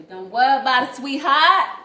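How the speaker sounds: loud mock reassurance. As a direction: belt it out like doting on someone.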